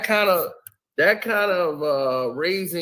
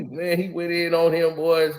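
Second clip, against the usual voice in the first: ordinary talk, nothing marked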